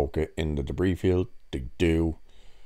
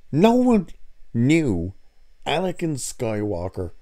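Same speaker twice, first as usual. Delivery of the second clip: no one pushed much higher and louder; emphatic rant; 7 semitones higher, 3 dB louder than usual. Real, not acted. Complaining to viewers about a plot hole, emphatic. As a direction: hammer home that nobody knew.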